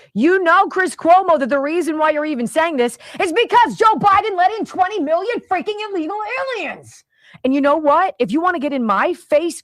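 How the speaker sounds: furious challenge. Real, not acted. Host dares Chris Cuomo to face him, shouting. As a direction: yell it at him, daring him to come.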